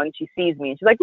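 ordinary talk, nothing marked

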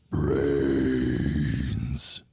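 slow low moaning groan; zombie groan. Acted.

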